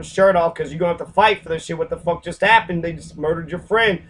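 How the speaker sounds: normal talking voice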